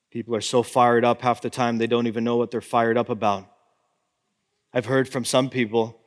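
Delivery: ordinary talk, nothing marked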